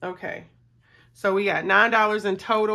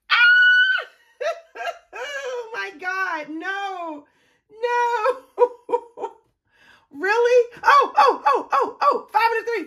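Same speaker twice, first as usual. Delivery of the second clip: high squeals and shrieks of laughter, much louder; real excited screaming; 13 semitones higher, 5 dB louder than usual. Real, not acted. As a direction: shriek with real delight at the lucky roll. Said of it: "I get so excited".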